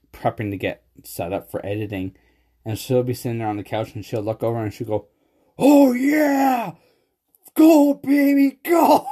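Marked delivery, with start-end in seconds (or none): 5.6-9.1 high, loud, drawn-out cheering whoop; mock excited yell. Wife mocking husband's excitement over his cards, mock excited yell. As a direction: imitate someone's overexcited cheering at full volume.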